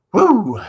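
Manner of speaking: loud rising woo; fired-up whoop. Real, not acted. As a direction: let out a pumped-up whoop. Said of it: "I'm worked up".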